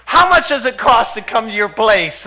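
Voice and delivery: suddenly keen, pressing the price; eager question. Retold or imitated. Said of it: "And he goes".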